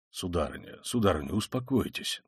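low, soft calming appeal; soothing. A narrator's reading. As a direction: gently try to settle her down. Official tries to calm frantic woman. (тихо, низко успокаивать)

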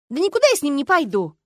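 loud, high indignant refusal. A narrator's reading. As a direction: protest hotly, pitch jumping up. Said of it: "возмутилась я".

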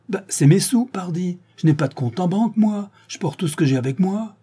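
high, pleading voice of an accused man defending himself; protesting accused. Acted. Séraphin answers the prosecutor. (accusé qui proteste, voix aiguë et pressante)

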